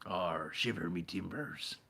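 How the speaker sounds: lightly hammed pirate growl, slow; a hint of a salty pirate. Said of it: "Why am I still talking like a pirate?".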